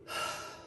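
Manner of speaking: soft exhale, no voice; weary sigh. Real, not acted. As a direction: let out a tired, frustrated breath. Venting about life direction.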